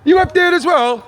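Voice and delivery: very high, loud call. Real, not acted. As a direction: shout up in surprise to someone above. Calling up to another person.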